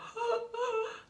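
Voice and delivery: imitating the nurses' gasp, high and sharp; a quick scandalized gasp with a laugh in it; mock shocked gasp. Mocking the nurses gasping at being called a fucking asshole.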